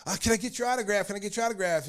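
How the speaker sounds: put-on a boy calling out to his hero; kid yelling. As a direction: shout after him like an excited kid. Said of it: "I'm yelling".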